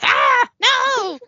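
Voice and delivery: high, loud mock scream; acted scream. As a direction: cry out in hammed-up terror. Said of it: "J P starts to scream".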